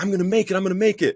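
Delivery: very high, giddy chant; high-pitched, elated self-cheer; retold excitement. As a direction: squeal it with hopeful excitement, reenacting the moment. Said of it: "I'm all excited 'cause I have five days".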